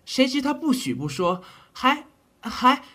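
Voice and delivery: words tumble, too upset to finish; agitated. Acted. Doctor to the general, indignant and flustered.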